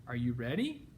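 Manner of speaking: ordinary delivery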